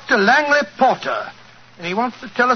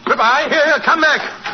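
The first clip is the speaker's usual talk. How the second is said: higher, slowed call to stop; calling after someone; 4 semitones higher than usual. Acted. Watson calls after the fleeing impostor, urgent shout to come back. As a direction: call him back as he flees.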